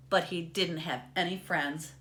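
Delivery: usual speaking voice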